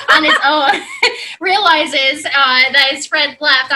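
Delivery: laughing. Real, not acted. Laughing through the punchline.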